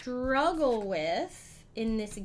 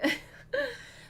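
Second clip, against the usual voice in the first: high squealing giggle; giddy laugh. Real, not acted. Streamer giggling, flustered by the raid. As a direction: let a delighted giggle escape. Giddy after thanking raider.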